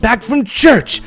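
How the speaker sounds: high, indignant outburst; yelling. A narrator's reading. Husband insults manager over Sunday mistake, yelling. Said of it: "started yelling at them".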